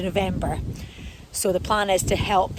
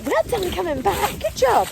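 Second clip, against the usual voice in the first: praising the dog; 10 semitones higher than usual. Real, not acted.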